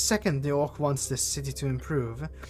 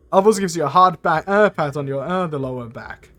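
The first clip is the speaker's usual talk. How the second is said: ordinary talk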